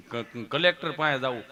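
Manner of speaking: low, stammering friend; Gabho. Acted. Friend wanting to see the collector, low and stammering. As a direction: stumble on the first sound, pitched low. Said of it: "મને કે".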